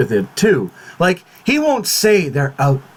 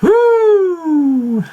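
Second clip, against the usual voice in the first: stressed exhale; 12 semitones higher, 3 dB louder than usual. Real, not acted. Venting after a spoiler scare.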